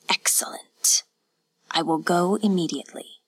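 hushed, whispery lord's voice; whispered demand from a master; cold lord. A narrator's reading. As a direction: question her in a cold near-whisper. Yasutoki answers the innkeeper's wife.

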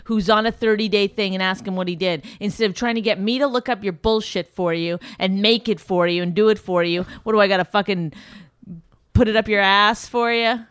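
loud, exasperated tirade; real mad rant. Real, not acted. Fed up with doing his juice-diet research, real angry rant. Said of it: "Wow, she was mad on that".

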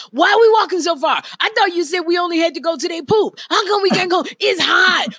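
loud, high-pitched mock complaint; whining teenager. Mother imitating her son on the dog walk, loud whining. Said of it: "he's like".

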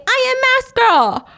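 a seven-year-old proudly playing her mother's role; child posing as Mask Girl. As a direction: show off like a kid in costume.

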